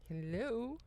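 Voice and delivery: normal talking voice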